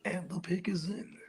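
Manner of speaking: hushed whispered golf announcer; golf commentator. Acted. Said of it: "Sound like a golf commentator".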